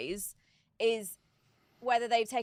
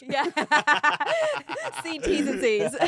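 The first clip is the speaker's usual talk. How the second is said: louder, laughing through the words; 6 semitones higher, 8 dB louder than usual. Real, not acted. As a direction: giggle out the punchline. Laughing at the coupon joke.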